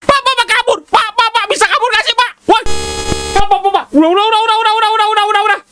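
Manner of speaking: terrified high pleading to the bapak to stop; panicked shriek. Real, not acted. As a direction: squeal in real panic as the monster appears. Panicked player begging Pak in game.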